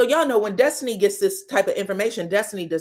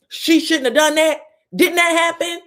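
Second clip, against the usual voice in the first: higher, louder indignant scold; 7 semitones higher, 6 dB louder than usual. Real, not acted. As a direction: call her out, loud and high. Vlogger scolding the cast member.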